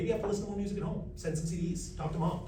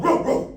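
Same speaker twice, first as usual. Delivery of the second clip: loud higher woof woof; dog bark; 8 semitones higher, 10 dB louder than usual. Shown as a demonstration. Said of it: "can I get a dog?".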